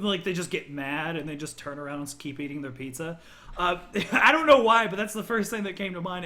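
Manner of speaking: ordinary talk, nothing marked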